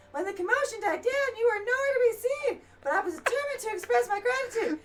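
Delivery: character's normal voice. Acted. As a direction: voice the grateful scholar up high. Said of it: "now I'll talk in his normal voice".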